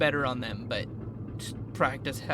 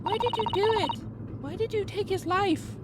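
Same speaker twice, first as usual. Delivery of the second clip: put-on much higher, pleading young woman; young woman's voice. Young assistant pleading with the accused, in a much higher distressed voice. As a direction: lift way up into a distressed girl.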